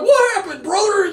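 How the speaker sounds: lightly hammed Hogan question. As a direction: play a baffled wrestler calling out. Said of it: "And Hogan's like".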